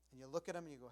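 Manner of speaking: ordinary delivery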